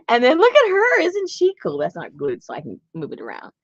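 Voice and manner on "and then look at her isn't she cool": high, loud, delighted showing-off; excited gushing. Real, not acted. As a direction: gush loudly over a favourite find. Showing off a favourite picture.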